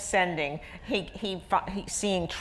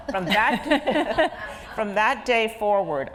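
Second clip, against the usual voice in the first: laughing; 5 semitones higher, 4 dB louder than usual. Real, not acted. Laughs recalling the leg lifts.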